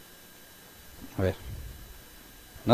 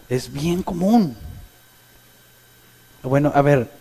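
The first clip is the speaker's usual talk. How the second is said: ordinary talk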